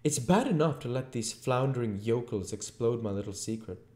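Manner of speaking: high, exasperated invisible tyrant; irate invisible Voice. A narrator's reading. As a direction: rant at your bungling helper.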